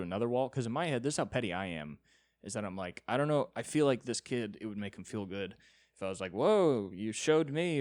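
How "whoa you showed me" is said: higher, louder feigned amazement; mock impressed. Climber humouring a six-year-old boy, mock impressed. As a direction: put on a wowed reaction.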